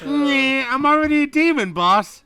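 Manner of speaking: high, loud blustering tycoon; oil tycoon voice. Retold or imitated. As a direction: boom it out like a rich oil baron. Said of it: "he talks like an oil tycoon".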